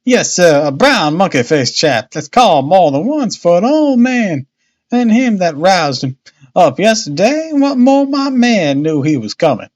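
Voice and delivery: uneasy boatman's wife. A narrator's reading. Narrator voices Missus Smith.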